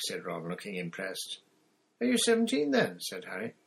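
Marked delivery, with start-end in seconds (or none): 2.0-3.0 put-on high, eager boy; young boy voice. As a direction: voice Harry lightly higher. Harry's voice in story.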